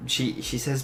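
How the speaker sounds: normal talking voice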